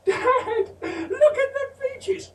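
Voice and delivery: put-on very high, laughing child's voice; child calling out. Older son calling his dad about the peaches, high and laughing. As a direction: giggle it like a seven-year-old.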